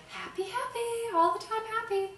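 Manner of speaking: high, singsong mock cheer; hammed-up, relentlessly happy chirp; fake Midwestern happiness. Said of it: "this sort of fake Midwestern".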